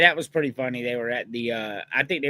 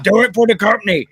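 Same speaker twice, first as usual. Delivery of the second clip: loud, higher mock Stone Cold bark; Stone Cold impression; 5 semitones higher, 11 dB louder, faster than usual. Friend retelling a wrestling backstage skit, loud Stone Cold impression. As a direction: belt it out like a fired-up wrestling boss. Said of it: "He's like".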